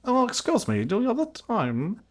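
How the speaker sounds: high, put-on British-sounding voice; high mock-polite impression veering British.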